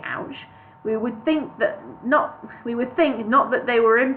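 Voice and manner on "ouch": mild ouch. Shown as a demonstration. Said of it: "were to say".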